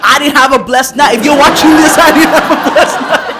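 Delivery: loud, high, laughing indignation; cracking up while complaining loudly; laughing protest. Real, not acted. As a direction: protest through big laughs. Laughing protest at informant's free blessing.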